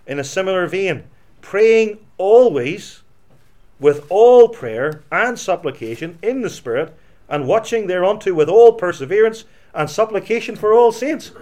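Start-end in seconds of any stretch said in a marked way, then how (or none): 1.4-11.3 forceful scripture reading. Acted. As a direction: proclaim the verse. Reads Ephesians aloud with force.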